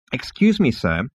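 high start, dropping to a cool "sir"; icy. Acted. Insect receptionist rebukes a pushy visitor, icily. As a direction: put the rude customer coolly in place. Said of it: "said the insect icily".

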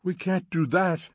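high, wailing objection. A narrator's reading. Mrs. Bowles says it is forbidden, wailing. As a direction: keep up the upset wail. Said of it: "wailed Missus Bowles".

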